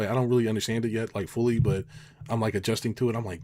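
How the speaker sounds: usual speaking voice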